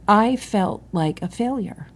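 ordinary talk

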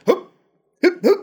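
high, short effort grunts; light, high-pitched mock exertion; strongman noises. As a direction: imitate a strongman hoisting a weight. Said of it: "his strongman noises".

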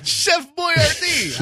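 high, drawn-out mock Italian; slow, hammed-up Italian accent; fake Italian accent. Shown as a demonstration. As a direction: stretch it out in cartoon Italian. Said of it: "do the fake Italian voice".

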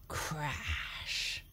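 ordinary delivery, nothing marked

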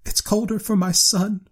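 put-on high-pitched, grieving old woman; tearful. A narrator's reading. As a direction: answer through a mother's grief. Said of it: "and wept afresh".

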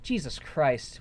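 ordinary talk, nothing marked

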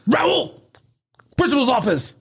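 high sharp call of a teacher; stern teacher. Retold or imitated. Teacher catching a kid with spitballs, high stern call. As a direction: call the kid's name up high, then send him off.

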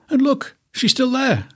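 high squeaky cub; a little bear pointing in high alarm; high little bear, startled. Acted. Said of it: "said Little Bear".

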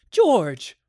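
high, loud cry of her husband's name; tearful cry. A narrator's reading. Shaken wife calls to her husband outside the nursery, crying out. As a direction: call out in acted distress. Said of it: "and she was crying".

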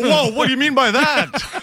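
high, loud, offended guest; defensive guest. Retold or imitated. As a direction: bristle as if accused. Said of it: "someone more defensive".

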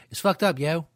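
Southern twang. Retold or imitated. Host redoes the Sessions line with a twang, mock Southern accent. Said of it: "with a twang".

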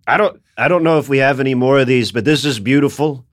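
a hint of a gibbering discount-store cashier; Donald Trump. Comedian mocking him as a liquidating-store cashier, light impression. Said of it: "makes him sound like".

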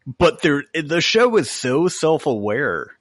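normal talking voice

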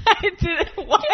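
laughing in disbelief. Real, not acted. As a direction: talk through a burst of laughter. Laughing at absurd claim.

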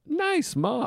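very high-pitched mock delight; played-up high little cheer; pleased welcome. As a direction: coo it as a happy reaction to mom calling. Acts welcoming a mother's call.

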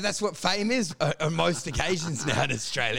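ordinary talk

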